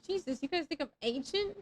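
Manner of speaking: ordinary talk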